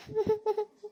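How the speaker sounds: soft laughing sob; a quiet sob half like a laugh. Acted. Aunt weeping as she asks god for mercy, a soft sob.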